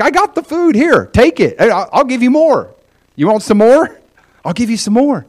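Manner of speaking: a father happily piling food on the table; generous father. Acted. Father urging his hungry kids to eat, eager and generous.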